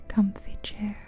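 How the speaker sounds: usual speaking voice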